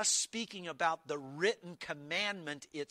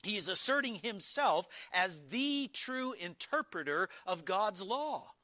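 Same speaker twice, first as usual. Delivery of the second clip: ordinary talk, nothing marked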